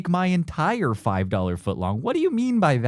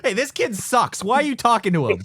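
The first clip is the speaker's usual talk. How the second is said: higher, lively hammed-up complaining; mock cranky dad; 9 semitones higher than usual. Co-host playing dad ranting at school visitor, hammed-up. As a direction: grumble about the kid in a silly voice.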